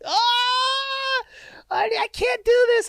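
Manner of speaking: high strained cry of pain; pained yell. Retold or imitated. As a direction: mimic a woman in labor pain. Girlfriend in labor pain, quoted.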